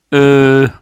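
loud "äh", then a determined rush; resolute. A narrator's reading. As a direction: hesitate loudly, then declare it with resolve. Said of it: "sagt dann aber entschlossen". (entschlossen und laut)

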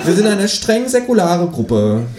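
viel höhere, freche Figurenstimme; Känguru-Stimme. Acted. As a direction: das Känguru hoch und keck sprechen. Känguru spricht. (hohe, kecke Känguru-Stimme)

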